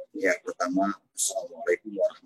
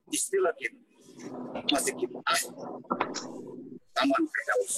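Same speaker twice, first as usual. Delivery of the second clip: garbled bad-signal voice, pitch jumping around; words breaking up over a weak line; choppy connection; 5 dB quieter than usual. Real, not acted. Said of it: "sinyalnya kurang bagus ya".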